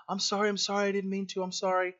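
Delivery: put-on rushed, anxious apology from a child caught out; scared child apologizing. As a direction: beg forgiveness like a frightened kid. Child caught breaking something valuable.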